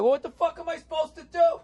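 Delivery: high-pitched imitation of a tipsy banker; drunk banker's protest. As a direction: squeal it helplessly, mimicking a drunk friend. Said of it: "we were a little bit drunk".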